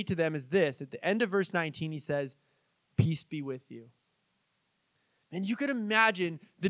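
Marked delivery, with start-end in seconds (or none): 3.0-3.9 gentle calm. Retold or imitated. Jesus speaking gently.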